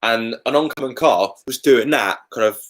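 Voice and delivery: usual speaking voice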